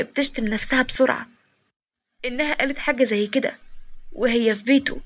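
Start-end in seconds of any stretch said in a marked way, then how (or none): none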